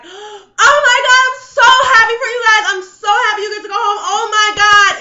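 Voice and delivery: high, loud, gushing delight; overjoyed squeal of congratulations; gushing friend. Retold or imitated. Imitating a friend gushing over their trip home.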